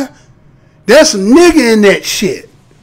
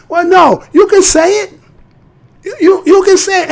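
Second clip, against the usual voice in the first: much higher, sing-song mock answer; 10 semitones higher than usual. Taunting the listener that they can say it, high-pitched. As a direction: toss it back in a high taunting voice.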